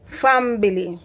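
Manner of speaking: ordinary talk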